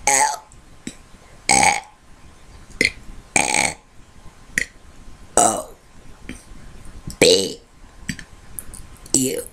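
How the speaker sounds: deep belched letters, one at a time; burping the words. Shown as a demonstration. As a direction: push each letter out on a burp. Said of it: "I'm going to try burping the A B Cs".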